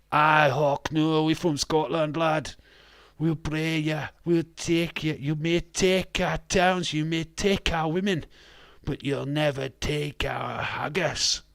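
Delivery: rallying cry in a put-on Scottish accent; mock Scottish battle speech. Streamer answering a Scotland shout-out, mock-defiant Scottish accent.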